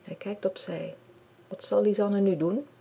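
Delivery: usual speaking voice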